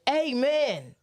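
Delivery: imitated church amen. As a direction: mimic a preacher calling amen. Mimics minister calling out amen.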